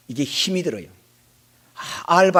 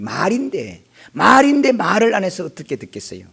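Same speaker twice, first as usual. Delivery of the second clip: usual speaking voice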